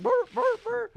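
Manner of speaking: loud, high imitated barks; bewildered pup yapping, imitated; dog barking. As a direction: bark on through the shocks.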